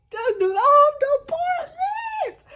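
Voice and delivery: high, slow, wailed imitation of sobbing; hammed-up blubbering, stretched out high; crying wail. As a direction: wail each word through mock tears. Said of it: "I started crying down".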